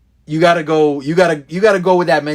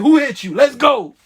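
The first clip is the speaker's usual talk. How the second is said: raised, eager call to fight back; a loyal friend rallies to the fight, eager and raised; ready to fight; 7 semitones higher than usual. Retold or imitated. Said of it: "you wanna be like".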